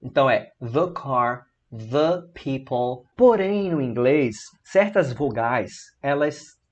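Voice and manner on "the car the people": "the car" with thuh; "the people" slowly, thuh. Shown as a demonstration. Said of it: "sempre the, esse puxado pro O".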